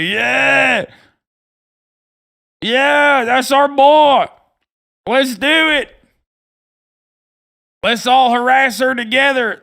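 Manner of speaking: loud, high mock cheering; rowdy crowd member. Streamer mocks the crowd egging on harassment, rowdy and hollered. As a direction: hype it up like an overexcited troll. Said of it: "Look how big the crowd got. It's like".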